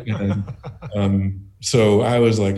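ordinary talk, nothing marked

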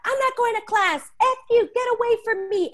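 put-on high, loud teenage outburst; retold yelling. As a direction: throw the words like a tantrum. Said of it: "And I'm yelling".

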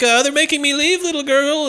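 very high, whiny plea; mock pleading. Nanny appealing to the little girl, high mock plea.

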